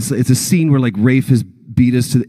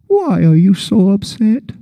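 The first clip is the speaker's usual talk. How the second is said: a higher, slow, gentle Pooh voice; Pooh impression; 8 semitones higher than usual. Pooh asking the panicked Piglet, slow and gentle. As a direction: do a slow, kindly bear. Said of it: "horrible impressions".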